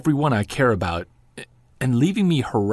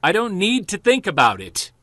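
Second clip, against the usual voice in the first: yell; 7 semitones higher than usual. Acted. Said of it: "I didn't mean to yell".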